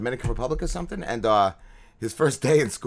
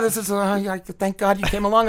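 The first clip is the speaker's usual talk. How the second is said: high, put-on voice of fake relief; sarcastic mock gratitude; 8 semitones higher than usual. Mocking the guy who wants a ride, high sarcastic thanks. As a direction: thank him like he's a savior, mockingly.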